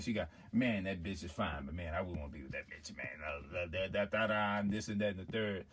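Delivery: low, soft guy-to-guy talk; man confiding in another man. Acted. Man talking privately to another man about a woman, low boasting. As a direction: brag low and offhand, as between two men.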